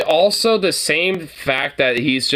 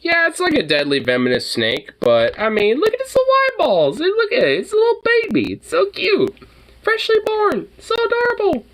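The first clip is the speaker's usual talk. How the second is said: sweetly morbid. Real, not acted. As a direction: coo at it, much higher. Cooing at baby cobra.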